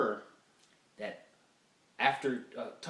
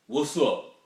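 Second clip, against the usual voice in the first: loud, abrupt man's "what's up"; imitating a loud manly greeting; mannish greeting; 9 dB louder than usual. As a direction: boom it out like a guy, unmistakably male. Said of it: "That was a fucking man".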